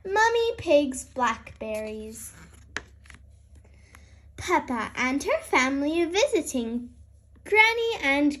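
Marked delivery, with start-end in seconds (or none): none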